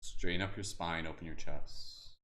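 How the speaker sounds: calm, unhurried meditation guide voice; guided meditation. Acted. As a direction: gently guide them inward and back out. Leads a meditation.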